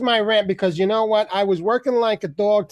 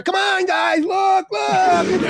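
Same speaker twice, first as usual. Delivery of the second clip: high, loud call for attention; eager shout; 11 semitones higher, 4 dB louder than usual. Retold or imitated. Calling to the Cops camera crew in an eager shout.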